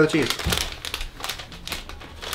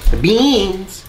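excited; 8 semitones higher than usual. Real, not acted. Excited cooking announcement.

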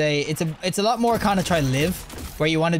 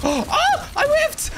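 gasp, then a much higher yelp on I whiffed; shocked gasp; much slower than usual. Real, not acted. Player misses a shot live, shocked high yelp. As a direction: caught off guard by his own miss.

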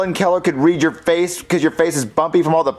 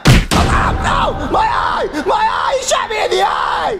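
high, yelping howl of pain; cry of pain; 13 semitones higher, slower than usual. Acted. Kid crying to friends after a toy dart hit, pained shriek. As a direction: screech as if hit in the eye. Just shot in the eye.

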